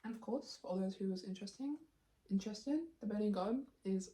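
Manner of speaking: ordinary talk, nothing marked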